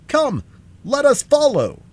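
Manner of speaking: high, loud call to action; Scarecrow's rallying cry. A narrator's reading. Rallying his friends to follow the chase, crying out. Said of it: "cried the Scarecrow".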